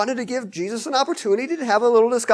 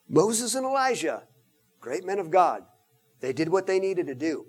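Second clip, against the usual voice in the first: ordinary delivery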